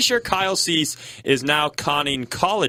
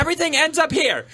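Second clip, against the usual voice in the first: very high, squeaky, thin; 14 semitones higher than usual. Pretend. Mocking high voice coach cue.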